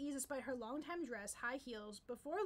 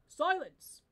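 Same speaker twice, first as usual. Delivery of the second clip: loud king's bellow; bellowed command; 3 semitones higher, 12 dB louder than usual. Acted. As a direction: roar it to silence the room. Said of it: "His Majesty's voice bellowed".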